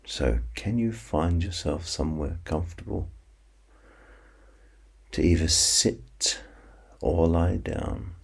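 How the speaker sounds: slow counting hypnosis voice; guided sleep meditation. Acted. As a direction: ease them toward sleep, unhurried.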